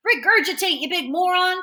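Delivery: mildly shouted, high-pitched command; shouted order. A narrator's reading. Freak orders his choking friend about, mildly shouting high. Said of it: "Freak shouts".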